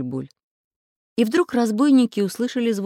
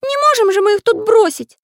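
very high, loud character voice; high-pitched, insistent plea; concerned Pencil character; 9 dB louder than usual. Acted. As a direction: object earnestly in a piping voice. Pencil pleads for the bandits.

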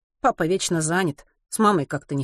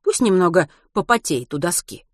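usual speaking voice

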